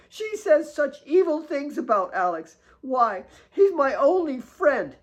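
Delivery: put-on high girlish voice, hurt and indignant; a child defending her only friend; upset girl. Kate complains about Claire.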